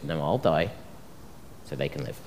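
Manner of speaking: low, soft resolve; quiet self-sacrifice. Acted. Good shepherd choosing to die for sheep, quiet and low. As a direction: drop low and soft, trailing down.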